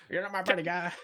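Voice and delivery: South Park Canadian. Retold or imitated. Co-host answers the joke in a South Park Canadian voice. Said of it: "or you go South Park with it".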